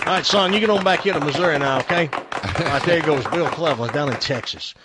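hammed-up Texas twang; put-on Texas accent. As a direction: holler it like a folksy Texan. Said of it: "if that's actually a Texas accent".

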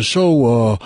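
quick, chirpy small-talk stranger; mock small talk. Mocking a chatty stranger at the casino table, quick and chirpy. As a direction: parody an eager stranger chatting.

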